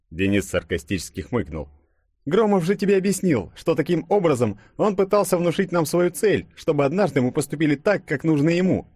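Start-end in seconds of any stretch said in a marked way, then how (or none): 2.2-8.9 high-pitched, argumentative character voice; explaining Denis. A narrator's reading. Denis arguing with Yulia about the false prophet, insistent. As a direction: reason with her in a raised pitch.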